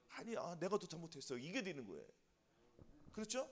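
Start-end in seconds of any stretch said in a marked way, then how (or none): 0.1-1.4 soft, lowered apologetic voice; acted apology. Preacher acts out begging forgiveness tearfully shared, soft apology. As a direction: drop your voice as if owning the fault. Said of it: "내가 용서를 비는 거는".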